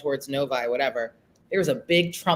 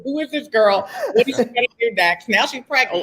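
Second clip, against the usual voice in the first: laughing; 7 semitones higher, 6 dB louder than usual. Real, not acted. Laughing retelling the story.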